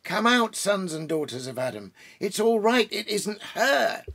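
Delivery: high, loud calls of relief; excited shouting. Acted. Beaver calling his family out of hiding, high excited shout. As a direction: shout them out of the cave in joy. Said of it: "he was shouting".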